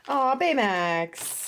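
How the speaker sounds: ordinary delivery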